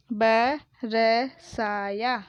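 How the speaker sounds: बरसाया broken into ba, ra, saa, yaa; बरसाया letter by letter with gaps between; spelled out. Shown as a demonstration. Teacher sounding out बरसाया for pupils, syllable by syllable. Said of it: "मैंने इस तरीके से पढ़ा".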